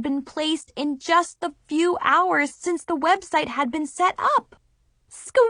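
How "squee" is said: high happy squeal; excited squeal. A narrator's reading. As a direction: squeal with delight. Shocked by new orders.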